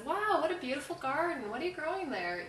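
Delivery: high, swooping admiring compliment; friendly interest. Acted. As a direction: act out chatty small talk with a gardener. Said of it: "somebody would be reasonably friendly".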